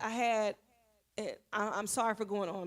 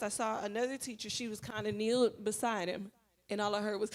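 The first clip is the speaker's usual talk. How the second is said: usual speaking voice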